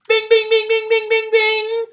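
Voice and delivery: high-pitched, loud mock bing bing bing; cash register bing. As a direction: ring it out like a slot machine paying out. Eyes light up at celebrity name.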